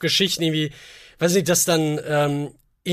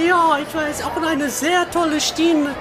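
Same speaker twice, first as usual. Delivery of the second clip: put-on waitress voice; 8 semitones higher than usual. Mulled wine seller greets her customer in a high put-on voice. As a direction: play a sweet stall waitress, pitched high. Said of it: "sehr erotischen Akzent".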